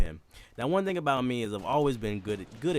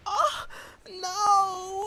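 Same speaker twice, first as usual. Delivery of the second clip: very high, louder dismay cry; high mock wail; 6 dB louder than usual. [name] realizing his friend snitched, high wail. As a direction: wail it high like a betrayed kid. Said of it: "I was just like".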